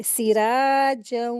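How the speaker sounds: "सिराजऊ" slow, long "रा" and nasal hum on the final "ऊ"; ghunna recitation. Shown as a demonstration. Teacher reciting the next word, slow merged ghunna. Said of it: "इसको गुन्ना करके मिलाकर पढ़ेंगे".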